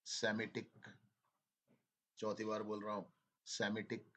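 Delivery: usual speaking voice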